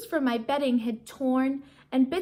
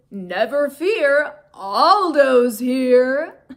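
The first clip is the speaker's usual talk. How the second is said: slow, loud hero call; roared; 10 dB louder, much slower than usual. A narrator's reading. Janitor bursting in to cheer the hamster, slow roar. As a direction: roar it like a superhero entrance. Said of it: "a familiar voice roared".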